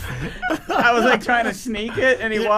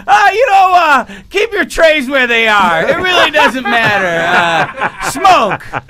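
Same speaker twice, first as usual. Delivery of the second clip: high, loud, mock-carefree announcement; hammed-up careless captain; reckless pilot; 4 semitones higher, 3 dB louder than usual. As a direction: pilot cheerfully announcing doom. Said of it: "If he was like".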